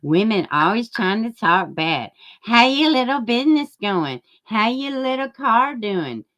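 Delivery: high, condescending mock voice; a podcaster mocking women, hammed-up. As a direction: sneer at women's little ventures. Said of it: "he's all like".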